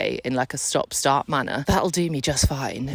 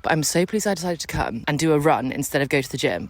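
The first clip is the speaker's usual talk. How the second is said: ordinary talk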